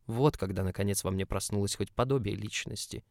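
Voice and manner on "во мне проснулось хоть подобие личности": plain reading voice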